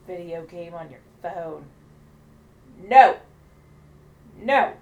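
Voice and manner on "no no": two loud, high bursts of No; emphatic refusal. Real, not acted. Co-host objecting to paying for phone games, emphatic shouted refusal. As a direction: shout each No separately, as an outraged refusal.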